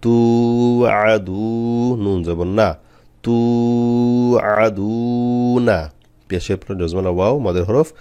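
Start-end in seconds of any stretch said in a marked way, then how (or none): 0.0-1.5 "তু" and "দু" held long on the vowel; one-alif stretch. Shown as a demonstration. Teacher showing learners the madd letters, long-held vowels in "তু আদু". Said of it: "এক আলিফ টান দিতে হবে". 3.2-5.8 "তু" and "দু" held long, "না" short; one-alif stretch. Shown as a demonstration. Teacher demonstrating madd to learners, long "তু" and "দু", short "না". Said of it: "এক আলিফ টান দিতে হবে".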